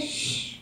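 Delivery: long, soft "shh"; quiet parental shush. Acted. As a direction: hush a child gently. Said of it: "turns towards Joshy and says".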